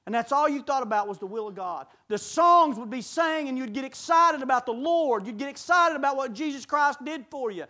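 ordinary delivery

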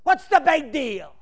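mock-quoting the people who complain about a fired-up preacher; mock complaining member. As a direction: whine it back at the preacher, slowing on "big deal". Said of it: "they say".

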